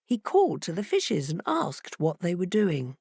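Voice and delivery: usual speaking voice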